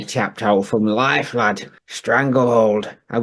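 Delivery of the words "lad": ordinary delivery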